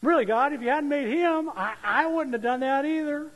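high, loud, whiny blaming Eve; mock Eve. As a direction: whine it, shifting the blame to God. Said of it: "And she said".

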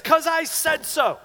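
high, loud, drawn-out retort; mock parental outburst. Parent to arguing kid, mock exasperated shout. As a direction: lay down the law in a slow mock yell.

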